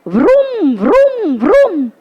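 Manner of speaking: revving rickshaw vroom, rising and falling; auto engine noise. Retold or imitated. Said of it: "How does it go?".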